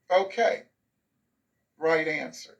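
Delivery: understated, cursory teacher approval; perfunctory acknowledgment. Acted. Teacher moving right along from your answer, perfunctory. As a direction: acknowledge it without any celebration. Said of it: "And it was just, and you moved right along".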